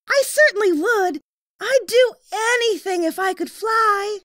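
put-on high, eager young boy; a young boy's high voice; little boy voice. A narrator's reading. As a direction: voice the small boy kindly. Boy's voice in story.

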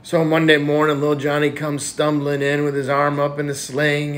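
ordinary delivery

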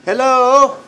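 very high, loud singsong call; calling to pet. Real, not acted. Owner calls hello to parrot, high loud pet voice. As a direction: sing out a greeting to a bird.

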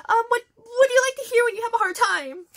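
high, loud, flustered asking; rushed nervous question. Retold or imitated. Fan rushing a question at the idol, high and flustered. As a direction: blurt it out in a fluster. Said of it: "And it was very rushed".

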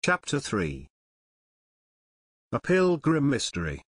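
ordinary delivery

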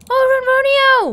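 high, loud, drawn-out cry of delight; excited greeting. Real, not acted. Thrilled player greets her new cat, high and loud. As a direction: squeal the cat's name with joy.

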